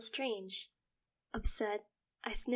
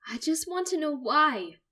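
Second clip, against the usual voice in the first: anguished; 7 semitones higher, 10 dB louder than usual. Acted. Narrator breaking down.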